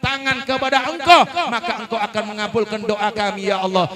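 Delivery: loud, fervent supplication with each ya Allah called out; fervent prayer. Real, not acted. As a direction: lead the congregation in pleading prayer. Leads closing prayer. (doa penutup yang lantang dan khusyuk)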